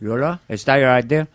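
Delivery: Tony Montana Cuban accent, mocking; taunting a fisherman in Cuban accent; Scarface Cuban accent. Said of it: "What if Scarface met Robert Shaw".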